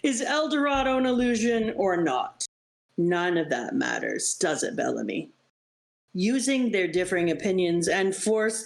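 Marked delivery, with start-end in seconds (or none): 3.0-5.3 much lower, cold sneer; low taunt. Acted. Villain taunting Bellamy.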